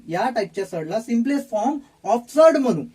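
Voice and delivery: ordinary talk, nothing marked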